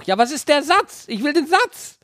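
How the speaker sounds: high mock demand for the sentence; impatient demand. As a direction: whine for the sentence, high and insistent. Voices impatient letter writer. (hohe, quengelnde Forderung)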